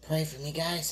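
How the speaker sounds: usual speaking voice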